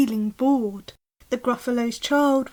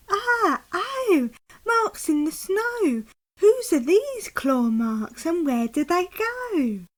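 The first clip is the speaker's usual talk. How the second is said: higher, excited little monster voice; eager Gruffalo child; 6 semitones higher, slower than usual. Acted. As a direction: pester dad like a curious kid. Child finds claw marks.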